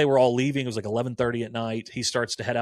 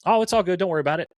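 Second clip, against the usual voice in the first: breezy; 7 semitones higher, 4 dB louder, much faster than usual. Retold or imitated. Freddie brushing off his worry.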